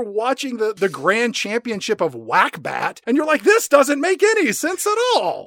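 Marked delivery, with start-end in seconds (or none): 3.4-5.5 high, exasperated mock complaint; mock bafflement. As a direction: whine it higher as a clueless viewer.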